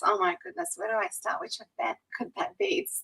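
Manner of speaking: high and hushed, giddy with delight; excited squeal. Real, not acted. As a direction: gush as if overwhelmed by memories. Flustered delight at the question.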